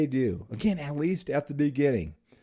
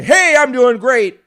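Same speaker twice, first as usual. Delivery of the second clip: much louder and higher, slow, booming "Hey, I'm doing great"; over-the-top loud reply; 11 semitones higher, 15 dB louder than usual. Shown as a demonstration. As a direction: blast the greeting way too big. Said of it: "you don't wanna say".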